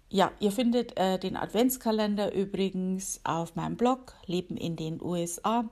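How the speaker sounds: ordinary delivery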